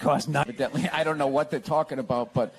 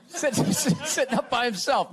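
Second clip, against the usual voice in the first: laughing; 6 semitones higher than usual. Real, not acted. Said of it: "I should say, not on himself".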